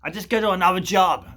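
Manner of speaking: loud, high put-on tradie voice; imitated tradie reply. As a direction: call it out as the tradie. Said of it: "And he's like".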